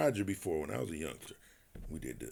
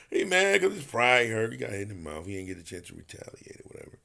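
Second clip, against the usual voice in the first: usual speaking voice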